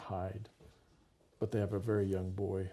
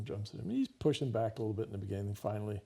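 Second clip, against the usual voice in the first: ordinary delivery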